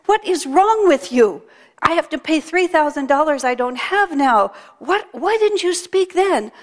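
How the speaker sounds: exasperated outburst. Acted. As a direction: demand answers from the parrot. Man berates silent parrot.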